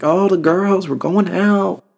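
mock girls announcing a night out; mock girly voice. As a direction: sing it out like an excited girlfriend. Mimicking girls heading out.

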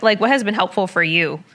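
usual speaking voice

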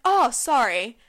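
loud, offhand mock sorry; imitated casual apology. Queue-jumper turning to the person behind, casually apologetic. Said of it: "she turned around and she was like".

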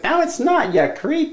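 high squeaky doll voice; Talking Tina doll. Retold or imitated. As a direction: squeak it back like a little doll. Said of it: "she's like".

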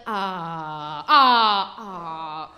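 gasping cries, one loud; mock shocked gasps. Daisy reacting to the news, gasping cries. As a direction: hostess gasping in mock horror.

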